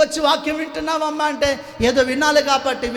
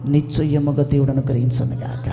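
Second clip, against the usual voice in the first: much lower, solemn blessing voice; solemn priest; 11 semitones lower than usual. Retold or imitated. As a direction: bless her gravely and low. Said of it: "దైవజనుడు ఇస్తున్న మాట". (పూజారి గంభీరంగా తక్కువ స్వరంలో ఆశీర్వాదం)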